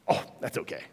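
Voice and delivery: soft, nearly whispered "oh, that's okay"; breathy unconcerned reply. Retold or imitated. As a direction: shrug it off under your breath. President-elect shrugs off salesman's warning.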